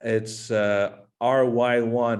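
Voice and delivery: usual speaking voice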